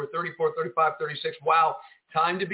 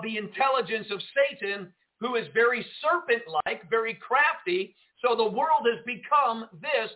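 fervent sermon delivery, a touch higher; preaching; 4 semitones higher than usual. Acted. Pastor warning his live broadcast flock, in fervent preaching tone.

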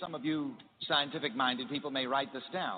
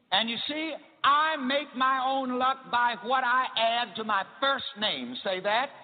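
rising, louder preacher's cadence; preaching; 6 semitones higher, 3 dB louder than usual. Acted. Preacher teaching his church, in full sermon cadence. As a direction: preach it up to the congregation.

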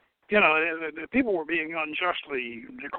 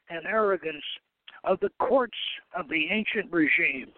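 reading a quote aloud; 3 semitones higher than usual. Acted. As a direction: weigh each phrase as you read it out. Reads a quoted passage aloud.

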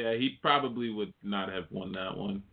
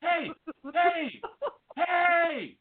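very high squealed hailing; over-eager calling out; much slower than usual. Pretend. Advising friend how to greet the singer, high hammed-up calling. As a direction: yelp it repeatedly like a pestering fan. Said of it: "You shoulda just walked up to him and was like".

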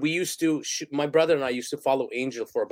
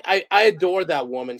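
ordinary talk, nothing marked

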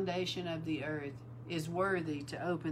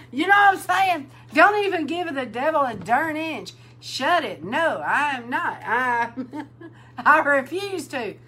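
laughing, shouting it out, much higher and louder; fiery preaching shout; 8 semitones higher, 10 dB louder than usual. Real, not acted. Preacher to the bride, shouting and laughing. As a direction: hammer it home with a laugh in the voice.

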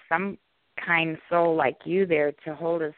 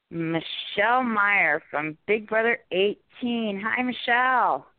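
slow, raised announcer build; guest introduction; 5 semitones higher, slower than usual. Real, not acted. Co-host introducing the Big Brother guest, slow announcer build. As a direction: draw out the name, lifting into a high greeting.

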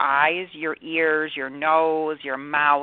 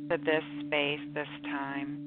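guided meditation; 3 dB quieter than usual. Acted. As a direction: ease them into calm, softly. Guided meditation over music.

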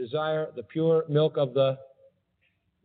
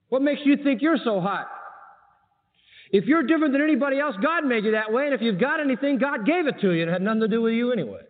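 rebuking; 9 semitones higher than usual. Retold or imitated. Voicing Paul rebuking pride.

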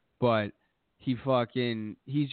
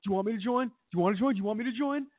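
much higher squeaky parroting; mocking echo, repeated; 10 semitones higher, much faster than usual. Young heel parroting Y2J over and over, high squeaky mock. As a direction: bounce the question back like a taunting kid. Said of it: "He's like".

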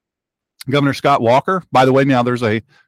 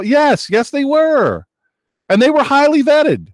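high-pitched mock-incredulous answer; sarcastic exclamation; 12 semitones higher, slower than usual. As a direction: answer your own question in a high, sarcastic voice. Sarcastic answer to own question.

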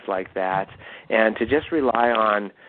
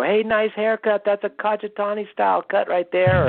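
imitating kids; 11 semitones higher, 3 dB louder than usual. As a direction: pitch up high like an excited student. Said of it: "the kids go".

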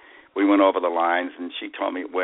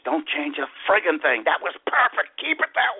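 excited director; 8 semitones higher than usual. Retold or imitated. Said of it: "He says".

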